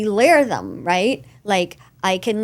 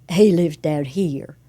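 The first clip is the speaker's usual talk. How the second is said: deep Mango voice; 5 semitones lower than usual. Acted. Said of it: "It's his Mango voice".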